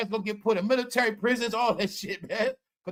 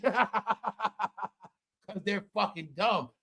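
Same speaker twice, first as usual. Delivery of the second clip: laughing; 6 dB quieter, much slower than usual. Real, not acted. Laughing at protesters.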